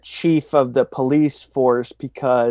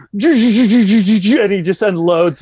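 gunfire noise; 5 semitones higher, 6 dB louder, much slower than usual. Retold or imitated. Imitating machine gun fire.

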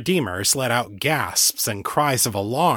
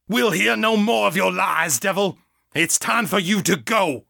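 preacher command; 8 semitones higher than usual. A narrator's reading. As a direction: drive the demon out. Exorcist commanding demon.

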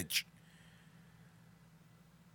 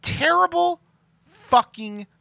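emphatic shout; 8 semitones higher than usual. Real, not acted. Ranting at bad content.

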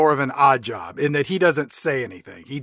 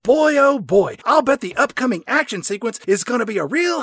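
high, folksy American salesman voice; mock all-American colonel, excitable and pitched up; Colonel Moon; 5 semitones higher than usual. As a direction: gush like a hammed-up American huckster. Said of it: "Moon says".

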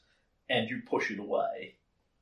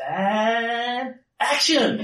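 Puck's showman cry; 12 semitones higher, 8 dB louder, much slower than usual. Acted. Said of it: "Puck goes".

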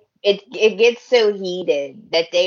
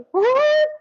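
shocked gasp; 12 semitones higher than usual. Real, not acted. Fan shocked by the reveal, gasping high "what?". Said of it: "reacting to a shocking twist".

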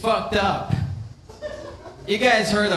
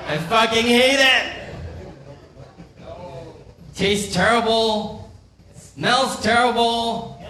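exasperated outburst; 6 semitones higher than usual. Acted. Korean comic confessing hatred of kimchi, exasperated outburst. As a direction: burst out with loathing, pitched up.

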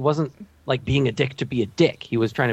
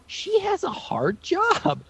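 mock sympathy; 3 dB quieter, much slower than usual. Real, not acted.

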